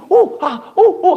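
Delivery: high, loud oohs and aahs; crowd gasps. Retold or imitated. A preacher mimicking the crowd cheering the crossing, high oohs and aahs. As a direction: gasp like a thrilled crowd. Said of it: "하면서 막 환호를".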